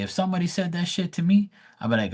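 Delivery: ordinary delivery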